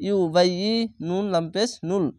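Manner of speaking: ordinary talk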